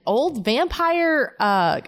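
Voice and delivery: ordinary talk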